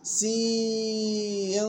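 سين drawn out very slowly with its ending held; nasal hidden nun demo. Shown as a demonstration. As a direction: stretch سين slowly and let the nun hum through the nose. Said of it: "إخفاء حقيقي ويغن بمقدار حركتين". (سين بإخفاء وغنة ممدودة)

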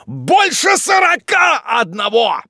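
slow, loud, high jeer; contemptuous exclamation. A narrator's reading. Wardle exposes his sister's real age, contemptuous shout. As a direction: drawl the figure out as a scornful shout. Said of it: "презрительным тоном".

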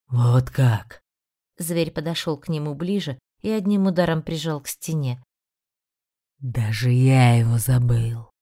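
amused ancient beast, scornful; mocking demon voice. (насмешливый голос демона)

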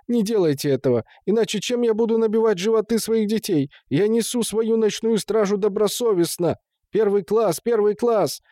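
high-pitched begging; panicked pleading. A narrator's reading. Watchman threatened with losing his pay, panicking. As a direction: beg frantically to keep your job. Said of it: "Гурка запаниковал".

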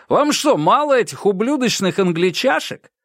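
high, scornful emcee voice; jeering DJ. A narrator's reading. DJ derides the English band to the audience, jeering. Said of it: "Он вышел на сцену и сказал".

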